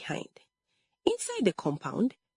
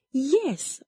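high, emphatic confirmation; cried out; 8 semitones higher than usual. A narrator's reading. Accusing sister insists to her mother, crying out. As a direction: cry it out with a jump in pitch. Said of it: "Olama cried".

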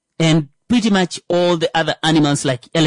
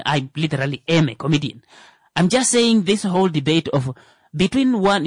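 normal talking voice

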